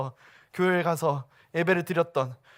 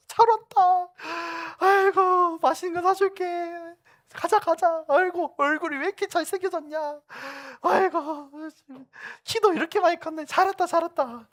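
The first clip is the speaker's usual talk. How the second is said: put-on higher, doting welcome of a returning boy; fussing church elders; 11 semitones higher than usual. Church teachers welcoming back the prodigal, doting and higher. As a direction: gush over him like proud aunties. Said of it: "선생님들이 막 너무 반겨준 거예요".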